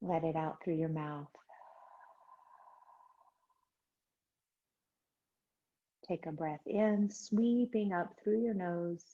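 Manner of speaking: soft, unhurried breathing cues; calm yoga teacher voice; guided meditation. Acted. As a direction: guide the class gently into the breath. Leads breathing and chant.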